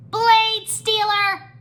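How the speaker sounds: high, loud, drawn-out insult; squabbling gecko. A narrator's reading. Gecko still arguing with monks, shrill angry shout. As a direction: squawk the accusation angrily. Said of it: "were still arguing".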